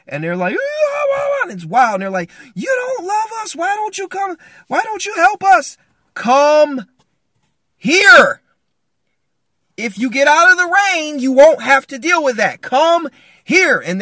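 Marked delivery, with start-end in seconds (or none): 0.5-1.4 very high, loud frightened howl; mock panicked wailing. Family stuck in the storm, hammed-up panicked wails. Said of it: "they're freaking out". 2.5-5.8 very high, wailing mock complaint; hammed-up freaking out. Scared family blames him from the rain, shrill mock wail. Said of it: "they're all scared, they're freaking out". 6.1-13.8 high, very loud call over a storm; commanding shout. Acted. Father in the cave yells to family, commanding shout.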